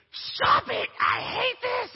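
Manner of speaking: high, loud imitation of a child yelling; kid yelling. Father imitating his tickled son, loud childish yell. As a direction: squeal it like an upset eight-year-old.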